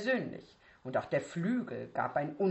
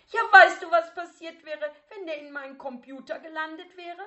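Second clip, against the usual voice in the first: much higher, louder voice rising in pitch; a teenage girl losing her temper; getting shriller; 11 semitones higher, 9 dB louder than usual. Acted. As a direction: immer schriller. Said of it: "dass ihre Stimme immer schriller wurde".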